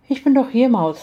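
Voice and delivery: ordinary delivery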